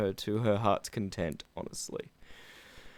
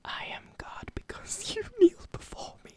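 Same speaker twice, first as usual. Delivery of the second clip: mock ASMR reading; ASMR whisper; 9 semitones higher than usual. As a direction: breathe it out like an ASMR video. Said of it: "just an A S M R one".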